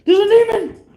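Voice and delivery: high, loud hammed-up panic with a laugh; mock terrified shriek. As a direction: squeal it like a panicked stranger. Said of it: "be like".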